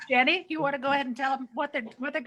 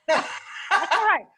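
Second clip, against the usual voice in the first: laughing; 7 semitones higher, 4 dB louder than usual. Real, not acted. Laughs over a teammate's loss.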